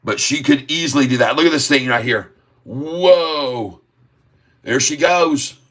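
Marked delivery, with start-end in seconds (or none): 2.6-5.6 excited whoa. Real, not acted. Stock jumping on the chart.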